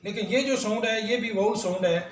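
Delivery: ordinary talk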